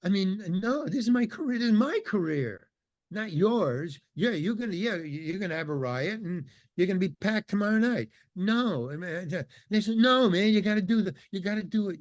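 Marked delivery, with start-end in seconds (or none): 0.0-8.1 higher, agitated disbelief; retold indignant protest. 10.0-12.0 higher, louder pushy demand; mock insistent pushing. Band pressuring him.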